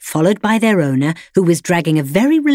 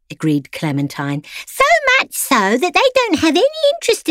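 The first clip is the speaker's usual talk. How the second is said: much higher, put-on voice of a pampered lapdog; prim pampered dog; 11 semitones higher than usual. A narrator's reading. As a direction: lecture him sweetly like a spoiled salon poodle. Clementine character voice.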